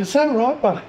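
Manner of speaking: mock retort. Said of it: "And I'm like".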